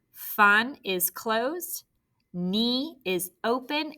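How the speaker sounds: very slow, each syllable laid out; slow syllable demo. Shown as a demonstration. Teacher breaking funnier into syllables, slowly. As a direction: draw out each syllable for the class. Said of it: "syllable types explained".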